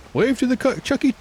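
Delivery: very high, loud cartoon parent; mock parent voice. Parent telling kids to wave goodbye, high playful voice. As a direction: squeal out silly farewells to passing things. Same parent farewell game.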